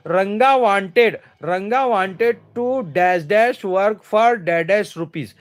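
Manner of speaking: very slow, word-by-word reading of the exercise; slow read-aloud. Shown as a demonstration. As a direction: read the sentence out slowly for the class. Teacher rereads exercise sentence.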